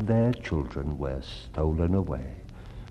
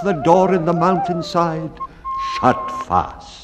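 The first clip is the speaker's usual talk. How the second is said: climbing higher and louder to the door slamming; building drama; 10 semitones higher, 6 dB louder than usual. A narrator's reading. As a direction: build toward the door shutting fast. Children vanish into the mountain.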